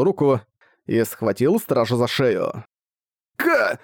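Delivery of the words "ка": strangled high yelp; choked gasp. Acted. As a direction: gasp as the throat is grabbed. Guard seized by the throat.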